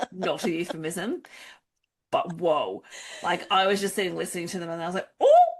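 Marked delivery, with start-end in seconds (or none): 5.2-5.5 a sudden high, loud oh; scandalised gasp. Retold or imitated. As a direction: caught off guard by the scene. Recalls gasp at spicy scene.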